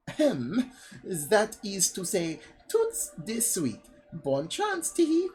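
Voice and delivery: high, slow mock French accent. Disguised woman wishing them luck, hammed-up French accent. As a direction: slip back into silly French, ending in a squeaked giggle. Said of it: "my voice just goes from French stereotype".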